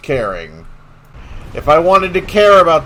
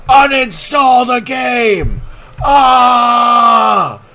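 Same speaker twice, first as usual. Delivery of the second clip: slow, high mocking yell; raging gamer; 10 semitones higher, 7 dB louder, much slower than usual. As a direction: bellow it like a sore loser.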